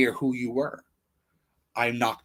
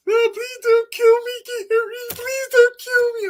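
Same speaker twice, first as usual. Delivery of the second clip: very high, slow squeaky mock pleading; Donnie begging; 7 dB louder, slower than usual. Mocking Donnie begging while being choked, very high slow whimpering voice. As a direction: whine for mercy in a tiny high voice. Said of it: "That's how he sounded".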